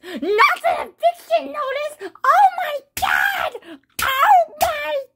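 high, slow panicked wail; panicked shout. Acted. Mario reading the eviction threat, panicked wailing. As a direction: wail it out like the world is ending.

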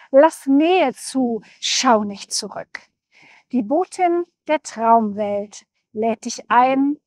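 measured poetic reading with lifted, emphatic line openings; poem recitation. Acted. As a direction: recite solemnly in rhythmic phrases, pausing between verses. Recites her soul poem aloud. (feierlich vorgetragenes Gedicht)

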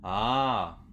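ordinary talk

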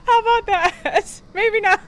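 high laughing aside; playful laugh. Real, not acted. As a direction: giggle it out, teasing. Joking self-aware aside.

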